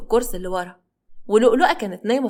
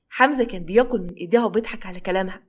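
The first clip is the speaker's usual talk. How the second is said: ordinary delivery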